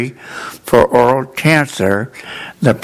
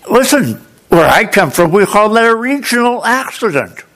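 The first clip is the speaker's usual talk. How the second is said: higher, defiant Boston voice; Boston accent; 7 semitones higher than usual. Retold or imitated. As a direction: stand up for his hometown, Boston style. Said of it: "known as a Boston accent".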